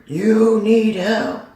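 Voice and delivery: high, slow, set-apart call; lightly voiced divine command; echoing heavenly voice. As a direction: recall it like a voice ringing from above. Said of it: "And then that voice".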